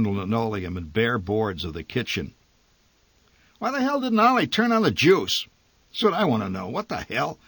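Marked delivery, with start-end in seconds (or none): 3.6-7.4 high, loud rage that drops off; raging father. A narrator's reading. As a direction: fume about Ollie in the dark. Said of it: "he raged".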